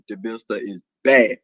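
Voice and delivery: very low deep register. Acted. Announcing to viewers the mister is back, deep voice. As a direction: repeat it from way down low. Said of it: "when this boys leave me".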